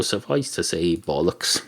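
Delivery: low, soft throwaway delivery of bollocks; quiet understated. Shown as a demonstration. Host dryly mutters his verdict, low and soft. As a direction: drop the voice right down on bollocks. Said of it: "That's what it suffices to say".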